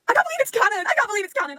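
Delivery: high, loud delighted squeal. Real, not acted. Fan to viewers, squealing that the joke is canon. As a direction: shriek with fan joy.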